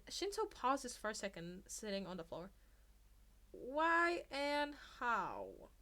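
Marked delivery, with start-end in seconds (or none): 3.5-5.7 slow puzzled. Acted. Shinso puzzled.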